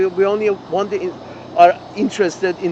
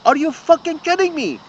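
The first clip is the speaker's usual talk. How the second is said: incredulous; 8 semitones higher than usual. Real, not acted. Ranting at a pundit's claims.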